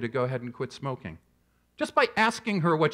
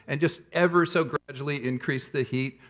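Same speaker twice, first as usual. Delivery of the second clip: usual speaking voice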